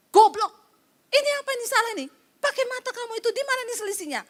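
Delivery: furious yelling boss. Retold or imitated. The angry boss yells at his employee over the wrong report, furious and high. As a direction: bark it out in a rage, pitch way up. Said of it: "marah, ngamuk".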